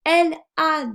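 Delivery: very slow, word-by-word reading; slow reading aloud. A narrator's reading. Teacher models reading for a beginning reader, slow word-by-word. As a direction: read deliberately with gaps between words.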